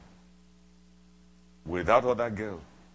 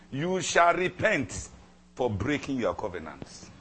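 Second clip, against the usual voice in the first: preaching emphasis; 5 semitones higher, 4 dB louder than usual. Real, not acted. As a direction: thunder it from the pulpit. Preaching admonition.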